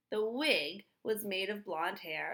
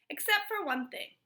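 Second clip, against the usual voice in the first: higher, louder pointed objection; Mister Dart objecting; 10 semitones higher, 5 dB louder than usual. Acted. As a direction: raise a finger with this one. Mister Dart correcting Stanley.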